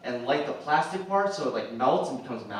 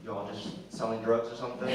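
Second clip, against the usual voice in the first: ordinary delivery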